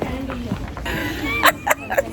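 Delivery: shrieking giggle at her son; high squealing laugh. Real, not acted. Laughing at toddler running off.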